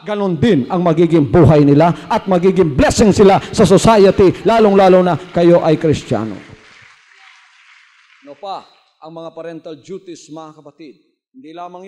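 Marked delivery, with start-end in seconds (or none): 0.0-6.3 loud preaching climax; full-force preaching. Real, not acted. Pastor exhorting parents about children, loud preaching. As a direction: drive the point home loudly.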